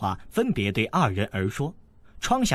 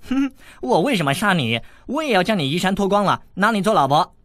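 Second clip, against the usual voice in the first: laughing tease; 8 semitones higher, 5 dB louder than usual. A narrator's reading. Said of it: "韦小宝笑道".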